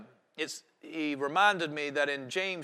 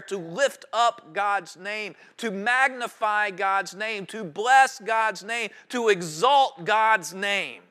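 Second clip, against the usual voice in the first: slow litany; 4 semitones higher, 4 dB louder, slower than usual. Real, not acted. Slow litany of praise phrases.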